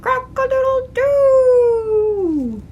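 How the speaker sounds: high, loud, slow rooster crow. Acted. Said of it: "heard a rooster crow".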